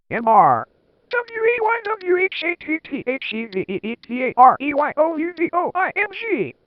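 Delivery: high, loud spelled-out mock shout; mock angry shout. A narrator's reading. Teacher catching students, hammed-up high angry shout. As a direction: bark each letter like a scandalised teacher. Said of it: "Rumbridge shouted angrily".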